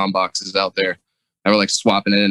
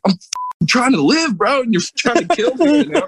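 much higher, yelling in outrage; furious yell. Retold or imitated. Diver yelling at deck crew after losing air, furious. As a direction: scream at the crew who cut your air. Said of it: "I'm like".

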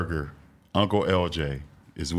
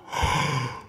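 sharp gasp; 5 semitones higher than usual. Retold or imitated. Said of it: "I said".

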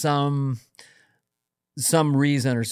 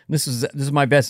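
ordinary delivery

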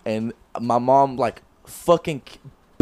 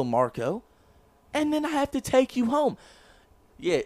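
ordinary talk, nothing marked

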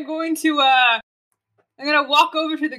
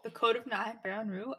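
soft muttered title "The Code of Nine"; quiet repeat; 11 dB quieter than usual. Shown as a demonstration. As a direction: murmur "Theron Rue" under breath. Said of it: "I thought you said".

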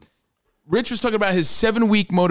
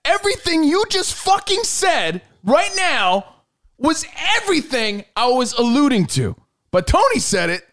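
higher, sarcastic frustrated rant; exasperated; 12 semitones higher than usual. Real, not acted.

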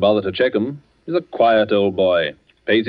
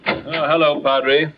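breathless from hurrying; puffing after rushing in; out of breath; 4 semitones higher, much slower than usual. Acted. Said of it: "You seem a good deal out of breath".

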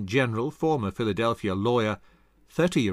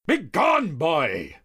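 put-on loud high bark at the boy; 6 semitones higher, 4 dB louder, much slower than usual. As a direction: snap him away.

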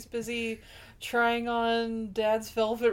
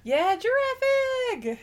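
excited exclamation; 13 semitones higher, 4 dB louder, slower than usual. Real, not acted. Excited recall of the egg.

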